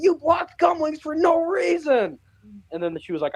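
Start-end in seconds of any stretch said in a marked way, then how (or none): 0.0-2.2 angry fan. Retold or imitated. Angry fan retold in story.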